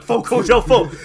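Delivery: loud, high, repeated cursing; loud mock swearing. Podcast host acting out the alien's swearing, loud and high. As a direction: blurt the curses out loud.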